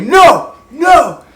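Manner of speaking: high, loud disbelieving no; retelling the dad's stunned refusal, loud and high; dad's shocked no. Retold or imitated. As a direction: cry out no, no in shock. Dad's reaction third time.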